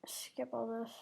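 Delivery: whispered aside; whispering. Real, not acted. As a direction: breathe it under the breath. Muttered to self.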